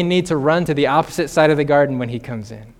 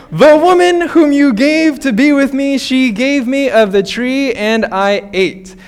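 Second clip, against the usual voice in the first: higher, louder whining excuse; whiny blame; 7 semitones higher, 4 dB louder than usual. Retold or imitated. Adam to God blaming his wife, loud whiny excuse. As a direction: whine the excuse to shift blame.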